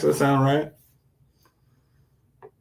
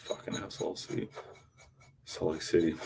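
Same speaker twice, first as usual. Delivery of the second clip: muttered aside; 3 semitones lower, 10 dB quieter than usual. Real, not acted.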